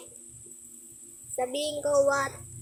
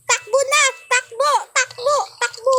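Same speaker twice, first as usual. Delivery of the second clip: high, loud urgent run calls; panicked urging; 8 semitones higher, 6 dB louder than usual. Real, not acted. Gamer yelling at friends to run from the murderer, high and loud. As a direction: urge everyone to run.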